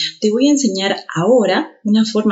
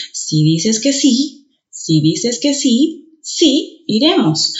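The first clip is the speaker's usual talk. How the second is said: slow, final sí higher; stress on the last sí; 3 semitones higher, slower than usual. Shown as a demonstration. Teacher slowly demonstrating stressed sí. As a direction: build to a stressed sí iremos. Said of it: "se pronuncian más fuerte".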